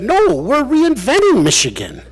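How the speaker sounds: high, put-on voice of the governor; governor's voice. Quoting the governor's reply.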